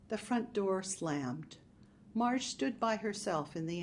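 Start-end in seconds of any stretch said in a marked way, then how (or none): none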